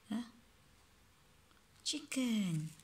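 ordinary delivery, nothing marked

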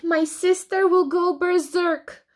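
high, loud anxious warning; fretful high-pitched wail; panicked. Acted. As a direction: protest as panic rises. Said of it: "panicked Alby".

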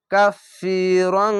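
কাফিরং slow with held nasal ং; Quranic recitation. Shown as a demonstration. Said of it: "নুনকে ধরবেন".

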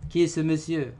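high, loud asking tune; questioning tone. Shown as a demonstration. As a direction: ask it with curiosity. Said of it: "On pose une question". (question haute et forte)

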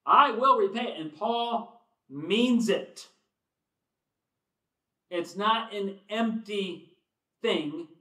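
slow, loud emphatic preaching; preaching at full force. Acted. Preacher stressing that Paul truly means to repay, slow and loud. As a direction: drive each word home.

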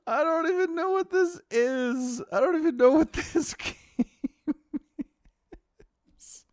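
words squeezed out between real laughs; laughing too hard to finish the sentence; breathless giggling disbelief; helpless laughter. Real, not acted. Caster can't stop laughing.

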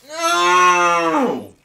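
high, loud cry of dismay; anguished shout. Real, not acted. Gamer fails.

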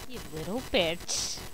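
low, soft venomous mutter; quietly menacing teacher; muttered insult. Acted. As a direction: say it low under your breath.

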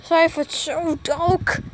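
normal talking voice

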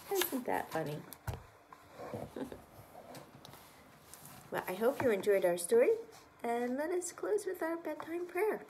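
plain reading voice

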